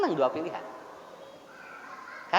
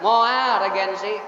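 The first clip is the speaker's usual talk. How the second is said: slow, drawn-out defiant no; husband refusing his wife, slow and stubborn; stubborn refusal; 4 dB louder, much slower than usual. Retold or imitated. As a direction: drag it out like a husband digging in. Husband refusing wife's choice.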